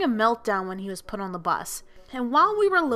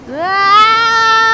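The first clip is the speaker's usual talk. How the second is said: high loud imitating a rider's scream; imitated scream; 10 semitones higher, 12 dB louder than usual. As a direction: mimic her shriek at the wind. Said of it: "she would scream".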